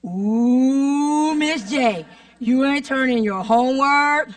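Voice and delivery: long drawn-out, sing-song "ooh" taunt; students teasing. Retold or imitated. Students catch teacher in trouble, drawn-out mock teasing. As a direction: imitate a class razzing their teacher. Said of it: "And the students are like".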